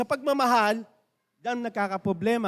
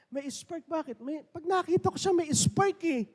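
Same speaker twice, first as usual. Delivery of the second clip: mock smitten; 7 semitones higher, 4 dB quieter than usual. Acting out love-struck person.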